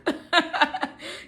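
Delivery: high-pitched burst of laughter; laughing. Real, not acted. Reader amused at how we talk to ourselves, laughing. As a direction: laugh at your own self-talk.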